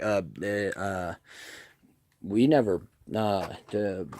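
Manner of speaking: broken, halting false starts; mimicked stuttering. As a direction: imitate someone who can't get words out. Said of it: "he can't really talk".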